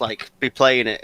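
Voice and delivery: normal talking voice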